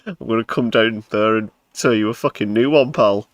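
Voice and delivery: a mock threat to the man downstairs; mock tough guy threat. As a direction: play the angry guest again. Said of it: "I know you're just downstairs".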